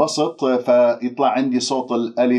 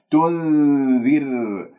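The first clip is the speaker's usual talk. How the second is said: very slow "تنذر", long nasal n; Quran recitation; much slower than usual. Shown as a demonstration. Teacher models the word, drawn-out recitation. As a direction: hold the ن, then a light ر.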